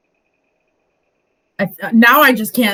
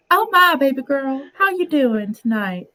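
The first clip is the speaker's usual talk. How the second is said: Southern accent, moderately raised pitch; lightly put-on Southern flirt trying to pass as a man; 7 semitones higher than usual. Shown as a demonstration. Streamer passing off as a man, Southern flirting voice. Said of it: "when I wanna pass off as, as a man".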